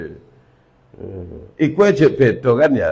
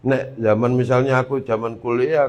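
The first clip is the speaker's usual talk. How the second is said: ordinary talk, nothing marked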